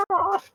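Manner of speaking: very high imitated shriek; high-pitched mock cry; mock scream. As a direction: squeal out a pretend scream. Imitates the woman being driven off.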